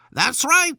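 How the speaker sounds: high, loud royal shout; shouting Queen. Acted. Queen approving the soldiers, high loud shout. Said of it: "shouted the Queen".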